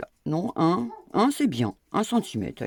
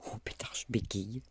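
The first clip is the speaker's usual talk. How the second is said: whispered, a touch exasperated; whispered aside; 8 semitones lower, 9 dB quieter than usual. Real, not acted. Stumbling over her words, she whispers to herself. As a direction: whisper it, amused at your own stumble.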